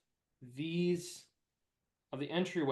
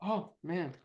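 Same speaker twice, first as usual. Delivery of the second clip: ordinary delivery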